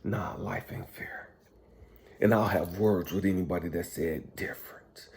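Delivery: hushed and bitter, barely voiced; real low whisper of defeat; resigned whisper. Real, not acted. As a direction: whisper it like a final judgment. Resigned verdict on life.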